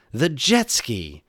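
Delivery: storybook hero's eureka; excited exclamation. Acted. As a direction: announce the gadget slowly, with relish. Story hero announces his idea.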